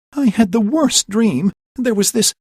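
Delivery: high, breathless and rattled; shaken. Acted. Hermia just woken from a nightmare, shaken. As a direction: still shaky from the dream. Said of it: "gasping for breath".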